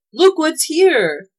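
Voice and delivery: high, loud, drawn-out shout; shouted call. A narrator's reading. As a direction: call someone over excitedly, stretching it. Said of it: "he shouted".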